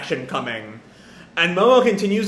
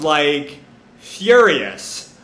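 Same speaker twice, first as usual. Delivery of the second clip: ordinary delivery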